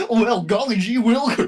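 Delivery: country bumpkin. Acted. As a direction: ham up a goofy country yokel. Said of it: "he's just some country bumpkin".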